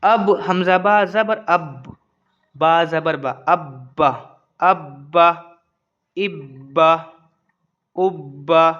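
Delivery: slow, measured syllable drill; spelling drill recitation. Shown as a demonstration. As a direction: recite each doubled-letter word separately, pausing after it. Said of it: "पढ़ देते हैं इसको".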